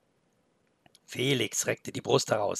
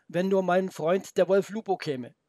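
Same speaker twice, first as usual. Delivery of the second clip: put-on boy's voice; 6 semitones higher, 4 dB louder than usual. Boy's thought.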